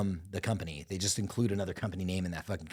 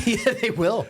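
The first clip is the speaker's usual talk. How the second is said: much higher, louder eager agreement; excited yes; 14 semitones higher, 7 dB louder, slower than usual. Real, not acted. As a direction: jump in high and loud agreeing.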